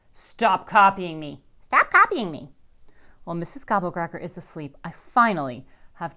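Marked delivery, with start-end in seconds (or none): 0.1-2.5 loud, rising irritation; annoyed. A narrator's reading. Violet scolds copying sister, loud and annoyed. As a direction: snap back twice, louder each time. Sibling quarrel.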